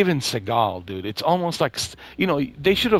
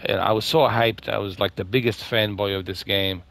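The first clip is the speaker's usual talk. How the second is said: normal talking voice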